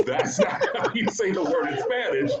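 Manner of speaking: high, laughing disbelief; laughing quote. Retold or imitated. Daughter teasing her mom's Spanish, high and laughing. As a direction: laugh through the correction.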